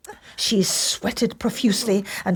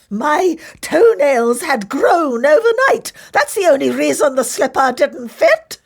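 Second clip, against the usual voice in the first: ugly sister protest; 10 semitones higher, 5 dB louder than usual. A narrator's reading.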